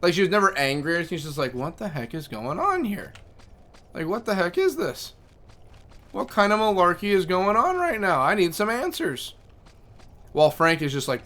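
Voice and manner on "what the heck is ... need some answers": higher, rising into a demanding cat; a bossy cat wanting answers, building up; indignant cat voice. Retold or imitated.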